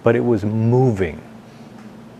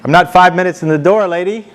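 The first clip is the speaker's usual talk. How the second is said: exasperated retort; 6 semitones higher than usual. Retold or imitated. Indignant retort to Thelma.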